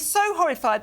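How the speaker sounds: ordinary delivery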